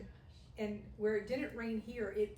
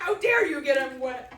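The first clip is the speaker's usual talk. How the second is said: mock outrage; 4 semitones higher, 14 dB louder than usual. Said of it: "my grandma hackles".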